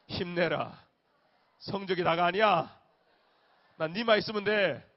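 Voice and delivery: loud, earnest cheer, rising to a shout; fervent encouragement. Shown as a demonstration. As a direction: pour your heart into cheering them on. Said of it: "힘들지?".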